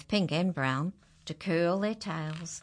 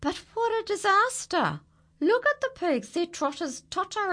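much higher, louder alarm; alarmed exclaim; 13 semitones higher, 4 dB louder than usual. A narrator's reading. Narrator cries out in alarm at the pigs in the tree. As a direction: sound the disaster alarm.

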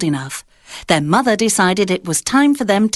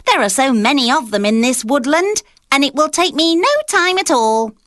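little pig voice; 6 semitones higher than usual. A narrator's reading. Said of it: "said the second little pig".

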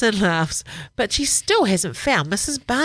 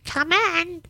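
put-on high, raspy old voice calling; raspy whisper; 13 semitones higher than usual. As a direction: croak it out like an old lady. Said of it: "A raspy voice says".

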